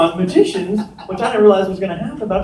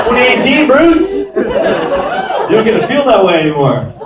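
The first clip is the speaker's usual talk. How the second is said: high, loud telling-off; hammed-up indignant reprimand; mock scolding; 8 semitones higher, 3 dB louder, slower than usual. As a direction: scold a heckler in a raised, indignant voice. Retold scolding of the heckler.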